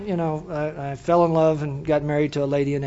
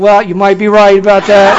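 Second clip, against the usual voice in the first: laughing mock agreement; 10 dB louder than usual. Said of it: "I said".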